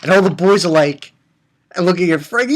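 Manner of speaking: normal talking voice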